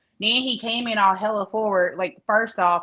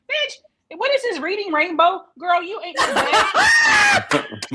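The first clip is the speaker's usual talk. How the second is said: much higher, louder, slow laughing shout; mocking shout; 10 semitones higher, 5 dB louder, much slower than usual. Viewer mocking a boastful character, shouting and laughing. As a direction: bark it out, laughing in disbelief.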